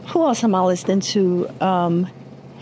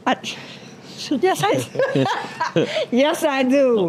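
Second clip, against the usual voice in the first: laughing, embarrassed admission, slowed down; sheepish laughing yes; 6 semitones higher, slower than usual. Real, not acted. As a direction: own up through a giggle. Admitting she likes [name].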